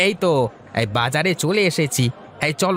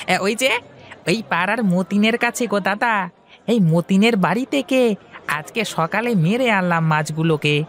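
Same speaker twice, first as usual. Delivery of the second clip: put-on high, eager young man's voice; young fish seller Hari; 8 semitones higher than usual. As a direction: talk up to your elder brightly. Young Hari answers Mahesh. (উঁচু গলায় উৎসুক তরুণ হরি)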